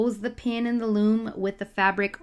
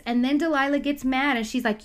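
normal talking voice

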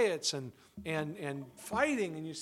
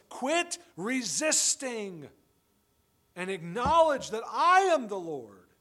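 higher, louder, very slow declaration; God commanding; 5 semitones higher, 8 dB louder, slower than usual. Acted. God addressing struggling humanity, a loud, slow, higher declaration. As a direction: proclaim it as the Lord. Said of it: "God says to us".

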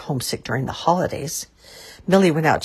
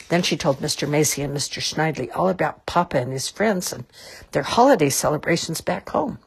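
usual speaking voice

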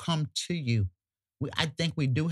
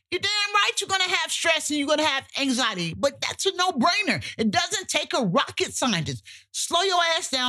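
much higher and fast, fed up; exasperated scolding; 12 semitones higher than usual. Real, not acted. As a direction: tell them off, half laughing at the obviousness. Scolding people who ignore stress.

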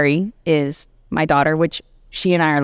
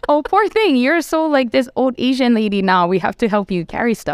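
put-on high, cooing pity for a poor old lady; mocking pity; 6 semitones higher than usual. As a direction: coo it down at her like the boys do. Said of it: "So they're just like".

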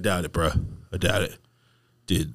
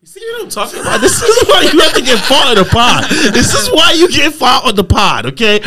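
mock scolding; 10 dB louder than usual.

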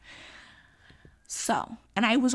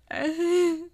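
exasperated laugh; 7 semitones higher, 6 dB louder than usual. Real, not acted. Laughing at her own situation.

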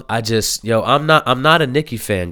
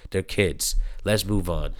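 ordinary delivery

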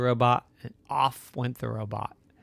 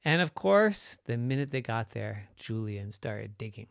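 plain reading voice

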